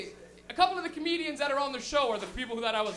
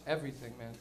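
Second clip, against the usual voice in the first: low, soft ominous dealer voice; the dealer, spooking a ninth grader with a low, quiet warning; 12 semitones lower, 7 dB quieter than usual. Retold or imitated. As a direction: drop the voice deep and hushed for the warning.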